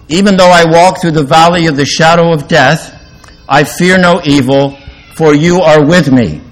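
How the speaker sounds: lifted, ringing scripture reading. Acted. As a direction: read the verse out like a preacher to a congregation. Reads the psalm verse aloud.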